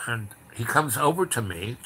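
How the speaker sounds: usual speaking voice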